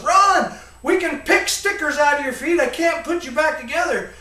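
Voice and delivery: loud, high, urgent shout; dad yelling at his son to get away. Retold or imitated. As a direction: yell it as the fuse burns down. Dad yells before explosion.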